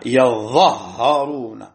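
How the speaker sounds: "يظهرون" as yaZZahharun, slow, both Za and Ha doubled. Shown as a demonstration. Teacher showing a Quran reading variant, "يظهرون" with doubled Za and Ha. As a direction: say "يظهرون" with a-vowelled ya and no long alif. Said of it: "بتشديد الظاء والهاء مع فتح حرف".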